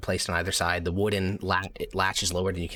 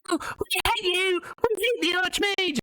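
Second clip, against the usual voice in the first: very high, slow heckling puppet voice; a tiny heckler booing the villain; squeaky crowd member; slower than usual. Acted. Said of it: "one of the members of the crowd says".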